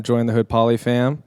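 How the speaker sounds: normal talking voice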